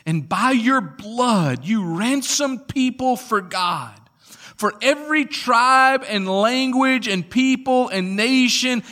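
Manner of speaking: high, soaring preacher's delivery; impassioned scripture reading. Real, not acted. Elders singing praise to the Lamb, soaring proclamation. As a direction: build it phrase by phrase in praise.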